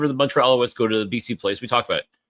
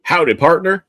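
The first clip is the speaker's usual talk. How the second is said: a light Southern twang on the greeting; cowboy greeting; 6 semitones higher, 5 dB louder than usual. Pretend. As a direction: greet like a cowboy, loud and drawn. Cowboy greeting, country theme.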